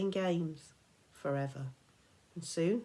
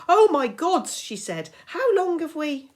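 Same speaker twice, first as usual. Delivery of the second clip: much higher, loud panicked voice; alarmed realization; 11 semitones higher, 13 dB louder than usual. Acted. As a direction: gasp it out in sudden panic.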